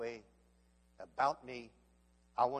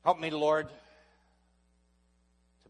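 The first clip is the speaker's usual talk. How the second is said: much louder cry to the Lord; loud prayer cry; 4 semitones higher, 9 dB louder, much slower than usual. Acted. Preacher crying out in prayer, loud. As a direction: cry out to God.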